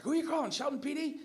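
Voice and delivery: high, loud confrontational scream; screaming, yelling. Retold or imitated. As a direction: yell an accusing challenge. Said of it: "starts screaming, yelling".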